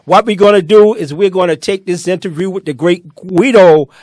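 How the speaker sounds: big, loud show-host announcement; hyped-up livestream host calling the room to attention; announcer intro. Acted. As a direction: welcome the crowd back like a showman. Host opens show segment.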